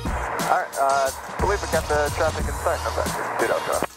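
tinny pilot call over radio; muffled cockpit radio reply; air traffic control radio. Real, not acted.

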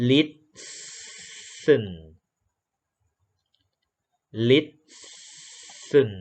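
"listen" as lisss-sn, a long hissed s and no t; silent T. Shown as a demonstration. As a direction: stretch the s of "listen" slowly, dropping the t. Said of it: "ตัว T ไม่ออกเสียง".

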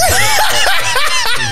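sudden high squeal of laughter; high burst of laughter. Real, not acted. To the host's Lamborghini quip, a high laugh.

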